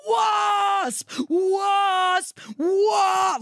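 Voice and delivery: high, frantic screams of the word; panicked shouting. Pretend. Imagining herself stung, shrieking in high panic. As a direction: yell it like you're being stung. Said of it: "I would have been like".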